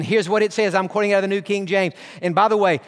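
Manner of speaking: usual speaking voice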